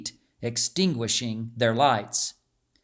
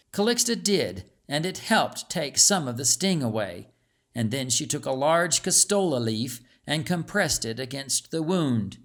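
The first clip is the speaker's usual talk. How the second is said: usual speaking voice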